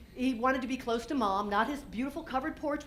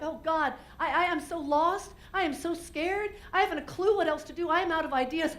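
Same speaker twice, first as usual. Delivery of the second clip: panicked; 6 semitones higher than usual. Retold or imitated. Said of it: "I'm like".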